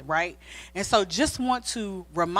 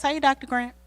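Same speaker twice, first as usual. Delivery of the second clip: usual speaking voice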